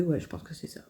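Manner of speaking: whispered aside to herself; a private murmured date check. Real, not acted. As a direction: drop to a breathy whisper, thinking aloud.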